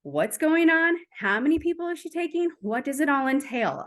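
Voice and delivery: a hint of an exacting planner; type A person. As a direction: fire off questions like an organizer wanting every detail. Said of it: "for my type A people".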